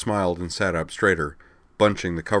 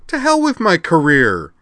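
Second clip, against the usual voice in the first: loud, high, defiant cry; angry outburst; 11 semitones higher, 9 dB louder than usual. A narrator's reading. Rena rejects Amanda's excuse, angry and loud. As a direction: throw it back at full volume.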